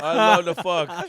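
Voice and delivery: loud, slow, hammed-up bragging voice; mock macho brag. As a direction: bellow it like a dumb macho bro. Mocking Danny's podcast persona.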